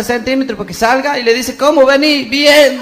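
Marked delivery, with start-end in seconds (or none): 1.5-2.2 loud call upward to a man far below; calling down a well. Acted. Compañeros gritan al santiagueño dentro del pozo, loud call. 2.2-2.8 shouted answer from below. Acted.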